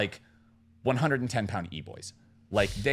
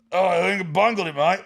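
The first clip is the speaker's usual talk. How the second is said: put-on drunk Australian; 5 semitones higher, 5 dB louder than usual. Drunk Australian admitting his mistake, hammed-up Australian accent. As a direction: imitate a sozzled Australian. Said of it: "large Australian man".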